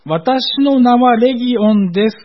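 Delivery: 私の名は said high and slow; slow, high emphasis on the word 私; stress on 私の名は. Shown as a demonstration. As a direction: lift 私の名は slowly to mark the singular. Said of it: "ここをよく見ますと".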